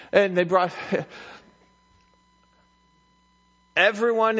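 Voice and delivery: loud, high, emphatic storytelling; preaching at full force. Real, not acted. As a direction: declaim it to a whole congregation.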